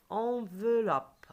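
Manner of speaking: "Enveloppe" stretched out for repeating; slow syllables. Shown as a demonstration. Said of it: "Répétez après moi".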